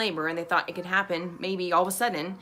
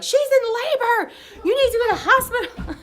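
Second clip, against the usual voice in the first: mock yelling strangers; 6 dB louder than usual. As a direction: play the pushy passer-by, yelling with a laugh. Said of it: "yelling at my husband and our doula".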